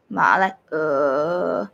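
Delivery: "ma" and "uh" sunk low and drawn out. Shown as a demonstration. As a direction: drop slowly to the bottom of your voice. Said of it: "very low".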